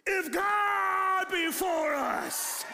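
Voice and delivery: high, very slow, loud shouted proclamation; preacher voice. Shown as a demonstration. Pastor acting out morning devotion reading, loud slow preacher shout. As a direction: belt it out like the pulpit at dawn. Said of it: "you're gonna say".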